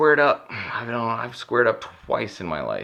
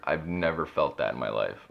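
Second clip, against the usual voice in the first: usual speaking voice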